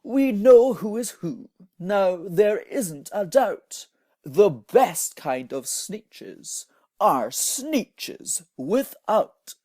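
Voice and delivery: loud, slow triumphant shout; hammed-up jubilant shout; proud shout. A narrator's reading. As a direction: crow it to the whole beach. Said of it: "they let out a shout".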